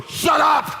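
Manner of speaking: high, loud shout of shut up; yell at dog. Retold or imitated. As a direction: bark it at the dog from the door. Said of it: "We open the door".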